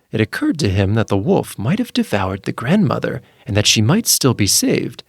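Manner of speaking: usual speaking voice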